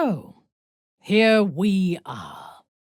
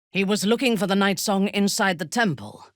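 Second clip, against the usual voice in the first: usual speaking voice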